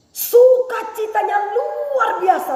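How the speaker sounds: exultant sermon cry; joyful preaching. Acted. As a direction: ring out the joy.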